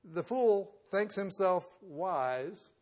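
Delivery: very slow, loud preaching; slow emphatic. Acted. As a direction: draw out each word loudly.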